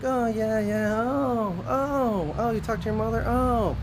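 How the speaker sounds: high, airy put-on oh yeah voice; mock-surprised relatives. Aunt and uncle humoring his revelation, high and mock-surprised. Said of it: "they're just kinda like".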